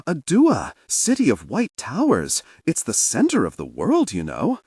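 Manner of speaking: high, loud and eager; enthusiastic boast. Acted. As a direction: sell the city with boyish glee. Orso boasting eagerly about Adua.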